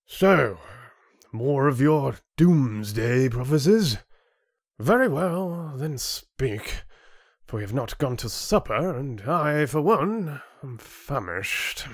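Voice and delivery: high-pitched, put-upon lordly voice; haughty chieftain, bored. Acted. Chieftain hurrying Rin before supper, bored and haughty. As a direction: indulge him impatiently, supper is waiting.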